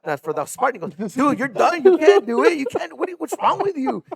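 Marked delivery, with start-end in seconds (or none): 1.1-4.0 much higher and louder, exasperated; a therapist throwing up his hands; exasperated outburst. Retold or imitated. Physical therapist tells coach he can't race, loud and exasperated.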